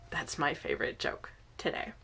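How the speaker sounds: ordinary delivery, nothing marked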